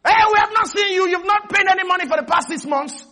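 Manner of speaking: high, loud phone demand; acted indignant debt collector; accusing creditor. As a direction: confront them over unpaid money, high-pitched. Acted creditor scolding about unpaid money.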